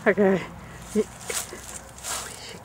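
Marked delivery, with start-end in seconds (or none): 2.0-2.6 startled unvoiced whisper; whispered curse. Real, not acted. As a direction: gasp it out under your breath. Startled in dark graveyard.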